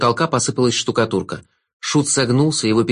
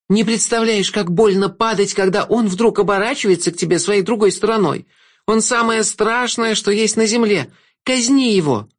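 much higher, pleading, stress on "не"; a wronged woman begging for revenge; high, urgent woman's voice demanding execution; bitter woman Agnessa; 9 semitones higher than usual. A narrator's reading.

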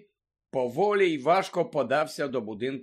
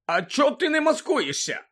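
high, loud, outraged shriek; screaming місіс Фіг; 7 semitones higher, 6 dB louder than usual. A narrator's reading. Old woman scolding her lookout, screaming. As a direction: yell it in a fury. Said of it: "закричала місіс Фіг".

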